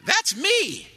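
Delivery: put-on very high, loud little boy crying out; preacher imitating his grandson's delighted yell; excited toddler shout. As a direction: shout it like a thrilled three-year-old. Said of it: "we heard him yell out".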